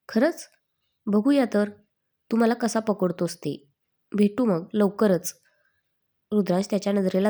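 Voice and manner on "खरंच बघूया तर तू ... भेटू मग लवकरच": usual speaking voice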